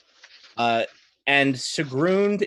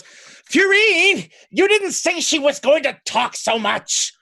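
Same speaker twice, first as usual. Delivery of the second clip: much higher and louder, a frantic screaming complaint; exasperated shout; 14 semitones higher, 6 dB louder, slower than usual. Acted. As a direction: yell it in panic while shaking your head. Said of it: "and he goes".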